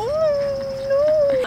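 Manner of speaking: very high, drawn-out wail; pitying dismay. Real, not acted. Owner grieving over her cat's stray past, pitying dismay. Pities the skinny stray cat.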